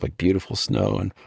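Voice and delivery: ordinary delivery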